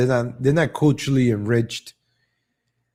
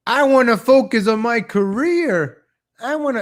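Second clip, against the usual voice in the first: mock career woman; 9 semitones higher than usual. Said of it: "This is when the women say".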